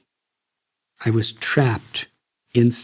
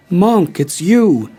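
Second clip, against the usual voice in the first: higher and louder, delighted surprise; joyful exclamation; 6 semitones higher, 5 dB louder than usual. A narrator's reading. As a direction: call out to a lost friend, overjoyed. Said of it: "exclaimed Jeff".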